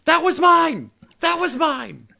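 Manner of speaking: high, loud indignant shouting; mock outraged yell. Co-host imitating Wes getting mad about the button, outraged yell. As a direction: yell it like you've been robbed. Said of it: "And Wes was like".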